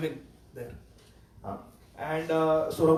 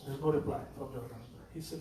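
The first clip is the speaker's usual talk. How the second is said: ordinary talk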